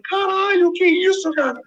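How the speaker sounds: high, stunned exclamation; shocked disbelief. Retold or imitated. Stunned neighbor reacts to a screaming mother, high shocked blurt. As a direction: blurt it out, caught off guard.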